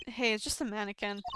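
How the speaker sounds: ordinary talk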